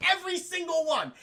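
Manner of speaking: high-pitched, through laughter; laughing disbelief. Real, not acted. Streamer recapping a silly plot, laughing disbelief. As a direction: get it out while cracking up.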